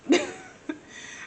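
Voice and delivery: airy giggle shooting way up; squeaky high giggle. Real, not acted. Laughs after "I'm just a girl.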